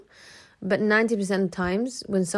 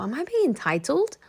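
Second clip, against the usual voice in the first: higher, anxious question to herself; worried self-doubt; 7 semitones higher than usual. Retold or imitated. Girlfriend doubting herself over the handbag, higher and uneasy. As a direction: ask it as if afraid of being greedy.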